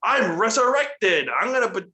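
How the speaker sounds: imitating a wrestler's grandiose return; Bray Wyatt. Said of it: "Bray Wyatt is back, and he goes".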